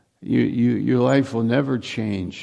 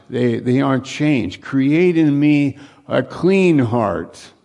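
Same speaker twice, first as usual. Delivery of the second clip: higher and louder, driving the point home; preaching at full force; 3 semitones higher, 4 dB louder than usual. Acted. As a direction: preach it hard to the congregation.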